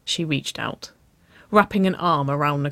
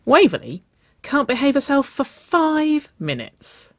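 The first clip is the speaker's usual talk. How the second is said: teasing tattle; 11 semitones higher, 4 dB louder than usual. A narrator's reading. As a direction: tell on them playfully, stretching the words. Teasing tattle on partner, she grinned.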